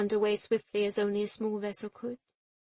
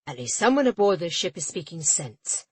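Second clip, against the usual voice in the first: louder, curt and clipped; a pompous official approving curtly; 2 semitones lower, 10 dB louder than usual. A narrator's reading. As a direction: snip each word off. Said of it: "the port official clipped".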